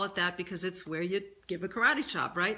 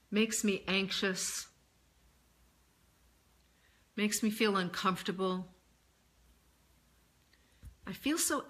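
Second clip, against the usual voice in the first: tapping reminder phrases. Acted. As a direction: voice the worries plainly while tapping each point.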